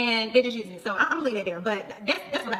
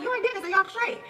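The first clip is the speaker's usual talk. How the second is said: mock innocent question; 8 semitones higher than usual. Her probing question retold.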